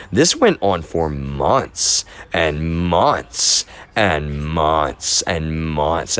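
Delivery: each "months" stretched out very slowly, with a gap after it; emphatic repetition. Real, not acted. Telling how long the situation went on, leaning heavily on each "months". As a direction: drag out every "months" to show how long it lasted. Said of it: "Because I wanna emphasize".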